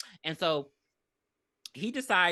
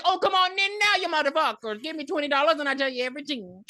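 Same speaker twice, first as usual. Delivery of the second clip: psychic character; 8 semitones higher, 4 dB louder than usual. Acted. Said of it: "they show up to the psychic".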